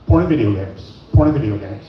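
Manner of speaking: deadpan low mumble, repeated; low flat answer. Retold or imitated. Husband to wife, a low deadpan answer. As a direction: drop low and flat, shrugging it off.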